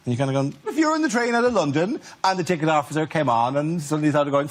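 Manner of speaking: ordinary talk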